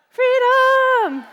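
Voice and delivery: Freedom flung out high and loud; rallying shout. Acted. Speaker rouses the room with a triumphant shout. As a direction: yell it like a battle cry.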